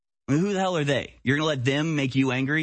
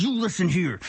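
put-on higher, snappish old man scolding; mock snap; 6 semitones higher than usual. Old politician snapping at a heckler, mock angry. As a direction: bark it like a cranky old politician. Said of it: "snap at them".

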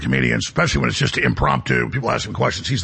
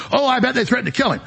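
mock speculation; 7 semitones higher, faster than usual. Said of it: "You can't just speculate and go".

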